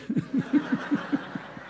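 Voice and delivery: high, wheezy chuckle; laughing at his own joke; amused laugh. Real, not acted.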